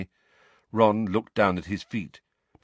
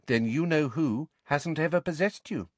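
higher-pitched, simple statement; simple, matter-of-fact; 7 semitones higher than usual. A narrator's reading. Ginny reassuring Harry he isn't possessed, simply. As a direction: state the conclusion simply, without fuss. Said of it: "said Ginny simply".

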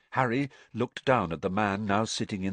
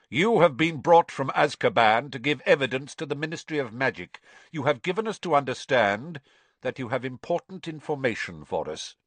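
a hint of a cold magistrate, a touch louder; lightly voiced stern judge; stern official; 6 semitones higher, 4 dB louder, faster than usual. A narrator's reading. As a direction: address the prisoner with formal authority. Crouch voiced in narration.

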